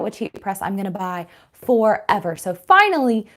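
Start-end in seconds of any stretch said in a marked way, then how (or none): none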